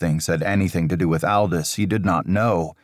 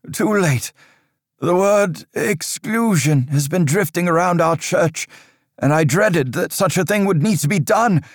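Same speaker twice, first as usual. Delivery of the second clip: a higher, louder stern priest's voice, measured and grave; stern old priest; 12 semitones higher, 3 dB louder than usual. Acted. Father Riker warning Aldous of dark times, stern and raised. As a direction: lecture the boy on dark times like an anxious cleric.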